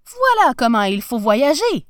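loud, satisfied exclamation; very pleased. A narrator's reading. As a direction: exclaim it with happy self-satisfaction. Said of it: "se dit-il tout content".

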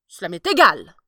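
dismissive emperor. Acted. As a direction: wave the objection away like a tyrant. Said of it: "dit le souverain".

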